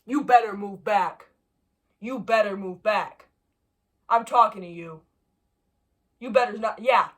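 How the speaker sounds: slow, loud warning; threatening. Real, not acted. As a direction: warn it off slowly and loudly. Threatening the bug.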